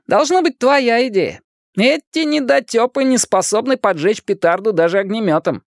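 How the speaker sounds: put-on high-pitched teasing kid; cheeky, high put-down; wisecracking boy, mocking. A narrator's reading. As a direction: needle them in a high boyish voice. Narrator plays wisecracking Richie.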